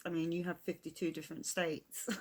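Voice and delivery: ordinary talk, nothing marked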